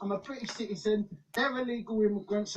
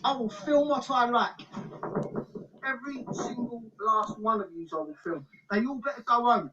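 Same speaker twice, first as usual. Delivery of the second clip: angry shouting; 4 dB louder than usual. Real, not acted. Angry confrontation filming official.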